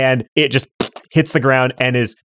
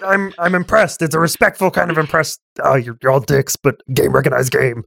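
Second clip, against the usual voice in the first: high, impressed grudging ogre; 6 semitones higher than usual. Acted. Ogre tells the party it respects them, high. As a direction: play the ogre, begrudgingly impressed. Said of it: "and now it's like".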